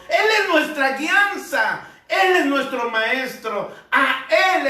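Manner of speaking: impassioned preaching. Acted. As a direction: proclaim it with rising fervor. Preaching at full force. (predicación fervorosa con voz más aguda)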